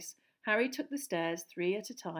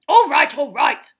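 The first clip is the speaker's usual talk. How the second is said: loud panicky interruption; 6 semitones higher, 19 dB louder than usual. A narrator's reading. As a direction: cut him off loudly. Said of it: "said Uncle Vernon loudly".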